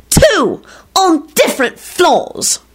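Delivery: high, drawn-out outburst; shriek. A narrator's reading. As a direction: shriek it, stretching the final words. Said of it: "Solange shrieked".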